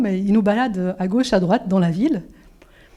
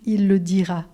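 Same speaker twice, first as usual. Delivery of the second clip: ordinary talk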